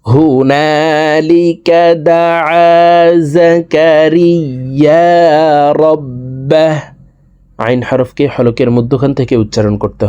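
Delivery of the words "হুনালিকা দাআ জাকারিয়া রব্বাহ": drawn-out, chanted and higher; slow Quran recitation. Shown as a demonstration. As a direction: recite it slowly in a lilting chant. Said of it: "দেখুন, এখান থেকে বলছি". (ধীর সুরেলা তিলাওয়াত)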